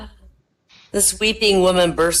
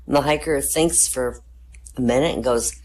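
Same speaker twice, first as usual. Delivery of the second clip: ordinary talk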